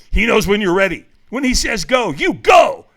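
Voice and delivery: loud, urgent mock whisper building to a shout; mock stage whisper. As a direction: imitate a stranger's intense, very loud stage whisper. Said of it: "he whispers in my ear".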